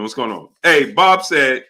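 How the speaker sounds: ordinary talk, nothing marked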